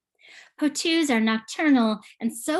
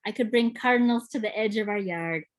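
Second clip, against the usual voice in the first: ordinary talk, nothing marked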